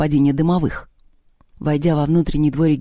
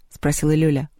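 plain reading voice

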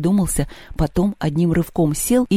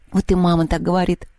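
usual speaking voice

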